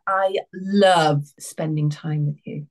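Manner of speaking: "love" leaned on, low and slow; "love" stretched out, low and drawn out like a come-on; stress on love. Retold or imitated. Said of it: "he put the emphasis on love".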